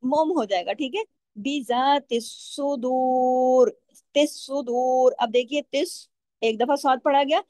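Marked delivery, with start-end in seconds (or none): 1.4-5.1 सुदूर slow with a heavy, thick swad; Quranic recitation. Shown as a demonstration. Teacher recites the verse to show the heavy swad. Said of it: "स्वाद को यहाँ मोटा भी पढ़ना है".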